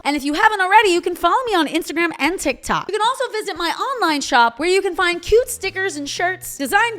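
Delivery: bright, high, loud sign-off to viewers; upbeat outro. Acted.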